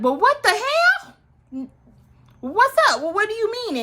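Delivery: very high incredulous group reaction; exaggerated high-pitched what-is-this protest; mock outraged disbelief. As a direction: act out shocked friends demanding answers. Girls react in retold scene.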